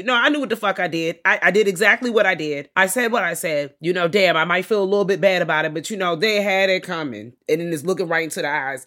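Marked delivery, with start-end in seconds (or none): 0.0-7.3 raised, unapologetic retelling in character; mock defiance. Voicing Pearl owning what she did, mock defiant. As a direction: own up to it without apology.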